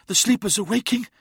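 high, strained warning; urgent hiss. A narrator's reading. Uthizar warns his brothers of the sleepers, high hiss. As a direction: pitch it right up, urgent and strained. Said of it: "hissed Uthizar".

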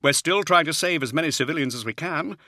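a harried Jaeger officer reporting under pressure; Hadziel, harried officer. Acted. Officer's voice held by narrator.

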